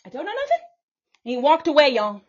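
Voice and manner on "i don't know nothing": jeering at her accuser in a high voice; mocking whine.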